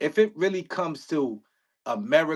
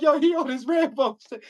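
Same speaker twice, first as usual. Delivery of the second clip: amused couch commentary; 9 semitones higher than usual. Retold or imitated. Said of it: "I'll be like".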